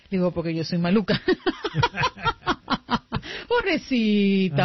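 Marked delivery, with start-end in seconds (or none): 0.3-1.2 lower, sly put-on voice; big sister admitting she's mean; mischievous. Mischievous confession. 1.2-3.4 high, long fit of laughter; gleeful laugh. Real, not acted. Laughs at own prank.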